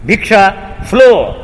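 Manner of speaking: storyteller's acted bellow for alms. A narrator's reading. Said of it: "he bellowed".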